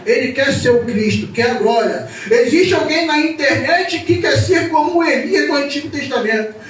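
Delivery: loud, high, impassioned sermon delivery; fiery preaching. Acted. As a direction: preach it out to the congregation. Preaching at full force.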